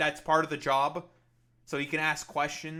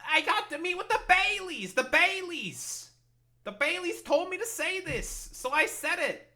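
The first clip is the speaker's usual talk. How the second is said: high, whiny mock of the senator; mocking Schumer voice; 12 semitones higher, 4 dB louder than usual. Streamer ridiculing Schumer's excuse in a high, whiny voice. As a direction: whine it up high like a pompous politician. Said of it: "But, you know".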